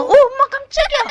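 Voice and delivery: loud, laughing jump-scare yelp; startled. Real, not acted. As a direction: blurt it out, caught off guard. Startled by hidden doll.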